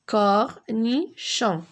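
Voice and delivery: "Cornichon" slow, syllables only briefly parted; syllables closer together. Shown as a demonstration. Teacher turning syllables into a word, syllables closer together. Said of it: "Je forme des mots avec des syllabes".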